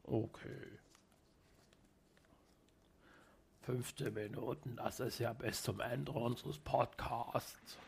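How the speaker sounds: grinding stone gravel voice; deep voice. Pretend. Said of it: "Sprich ab jetzt fünfzehn Minuten mit tiefer Stimme".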